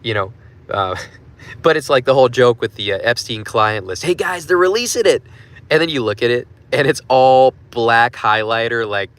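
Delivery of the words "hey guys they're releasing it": high-pitched, hammed-up hype; mock excitement. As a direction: gush it like a gullible announcer of big news. Mocking hype over client list.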